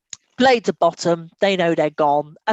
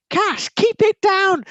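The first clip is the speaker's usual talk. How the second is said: younger brother voice; 11 semitones higher, much slower than usual. Retold or imitated. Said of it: "my younger brother was like".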